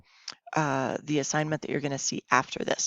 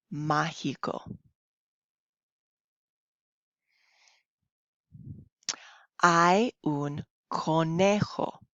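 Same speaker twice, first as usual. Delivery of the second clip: slow, clear reading to learners; slow story reading; much slower than usual. Acted. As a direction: read each phrase slowly for beginners. Reads story slowly to class.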